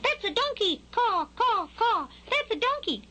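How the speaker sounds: very high, squawky bird voice; cawing crow. A narrator's reading. Crow answering Skylark's question, high squawking. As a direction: caw it out like a crow. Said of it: "A crow ... gave him the answer".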